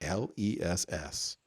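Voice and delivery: "L E S S" spelled letter by letter, slow and low. Shown as a demonstration. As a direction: spell out each letter of "L E S S" slowly, quietly. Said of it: "in case you couldn't understand".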